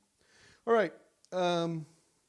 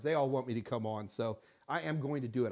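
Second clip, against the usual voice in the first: ordinary delivery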